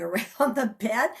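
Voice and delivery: usual speaking voice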